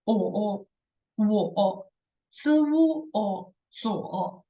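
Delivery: zuo built up from o, wo and zi, then the tone; correct zuo. Shown as a demonstration. Teacher building the correct pronunciation of zuo. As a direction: blend z and uo into zuo. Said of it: "this word's the correct, pronunciation".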